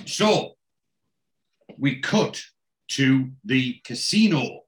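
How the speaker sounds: halting reveal with long pauses; dramatic build-up. Real, not acted. Host teasing a cameo to co-host, dramatic build-up. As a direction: spin out the announcement phrase by phrase.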